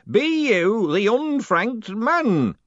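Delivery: put-on very slow, menacing old man voice; menacing old postman. A narrator's reading. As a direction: drag it out with menace. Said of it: "real menace in the voice".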